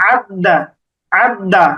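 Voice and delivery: আদ দা with the দ sounded twice; doubled tashdid. Shown as a demonstration. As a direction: hold the দ in আদ দা, then release. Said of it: "দুইবার পড়তে হবে".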